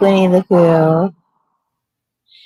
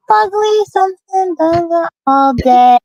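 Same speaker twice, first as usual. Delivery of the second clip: high cartoon voice; 9 semitones higher than usual. Silly put-on cartoon voice.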